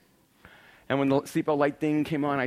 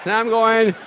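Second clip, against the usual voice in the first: usual speaking voice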